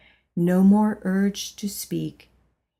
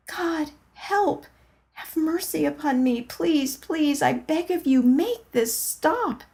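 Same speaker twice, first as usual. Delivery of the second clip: panicked plea; 8 semitones higher than usual. Acted. Begging God in panic.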